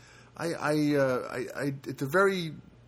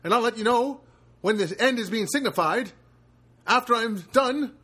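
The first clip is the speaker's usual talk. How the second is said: fifties voiceover guy. Acted. Podcast host opening the show as a fifties voiceover announcer. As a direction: open the show like a fifties movie narrator. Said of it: "Why am I talking like this? Like one of those fifties voiceover guys".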